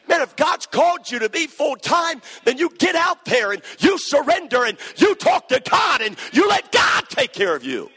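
high, loud, full-force preaching; preaching shout. Real, not acted. Preacher urging the congregation at full force. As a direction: shout the call to surrender.

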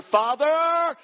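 high, drawn-out call to a father; demanding son. Acted. Younger son calling his father for his share, demanding call.

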